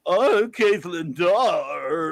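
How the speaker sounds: very drawn-out mock audiobook introduction; hammed-up narrator playing a woman; George Takei. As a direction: stretch the name out absurdly slowly. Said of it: "I can't even do it right".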